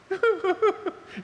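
high, burst-out laugh; delighted laugh. Real, not acted. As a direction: laugh out loud at the joy of it. Laughing at the draft story.

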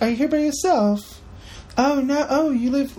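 put-on Scarlett Johansson in a high, shaky-at-best British accent; bad British accent. Podcaster mimicking Scarlett's questions for the co-host, not-great British accent. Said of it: "kind of not great British accent".

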